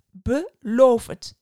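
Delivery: loud, slow, stern demand. A narrator's reading. Starling demands a promise from the boy, sternly. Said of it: "zei de spreeuw streng".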